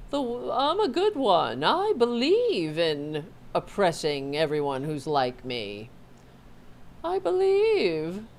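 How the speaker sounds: high, whiny self-pitying mock voice; mock Caitlyn Jenner. Mocking a celebrity's self-pity, high whiny voice. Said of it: "she doesn't wanna say anything bad about Trump".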